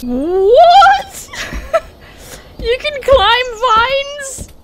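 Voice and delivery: high, slow astonished question; amazed disbelief. Real, not acted. Gamer amazed the bear can climb vines. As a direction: ask in delighted disbelief.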